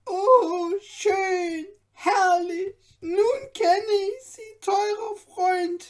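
high, loud, crying dwarf overcome with joy; weeping little dwarf full of joy; tearful rapture. A narrator's reading. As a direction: sob out the praise in happy tears. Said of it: "küsste mit Tränen in den Augen".

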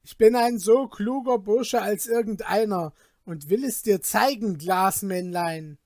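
loud, menacing boast; boastful threat. Acted. Peter threatens the Glasmännlein, loud and boastful. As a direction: threaten him, chest out.

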